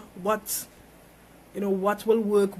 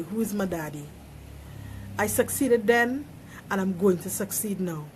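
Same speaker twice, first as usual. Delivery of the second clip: ordinary delivery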